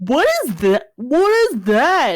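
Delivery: loud, rising hammed-up disbelief; alarmed character. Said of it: "And then Dos is like".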